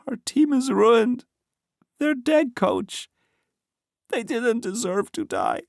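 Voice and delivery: high, slow, crying voice; tearful. A narrator's reading. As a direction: speak through tears. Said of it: "then burst into tears".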